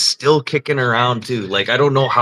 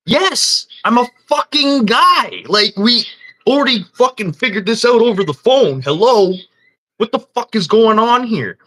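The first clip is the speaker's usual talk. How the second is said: higher, louder exasperated yelling at the staff; angry outburst; 12 semitones higher, 4 dB louder than usual. Retold or imitated. Retelling yelling at hotel staff over fraud, exasperated shouting. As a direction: blow up in disbelief.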